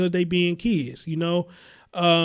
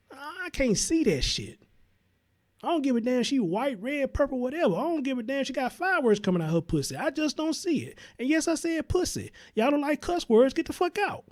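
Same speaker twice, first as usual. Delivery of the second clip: heated rant; 7 semitones higher, 4 dB quieter than usual. Real, not acted.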